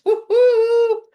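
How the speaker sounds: loud, high sports cheer; a fan's jubilant woo-hoo shouted at the camera. Real, not acted. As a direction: whoop for the Blue Jays. Cheering for her baseball team.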